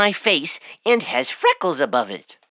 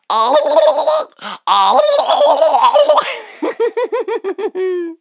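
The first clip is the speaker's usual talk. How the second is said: high loud gobbling; turkey gobble; 11 semitones higher, 4 dB louder than usual. Acted. Toy showing the child a turkey's call, high gobble. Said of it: "A turkey says like this".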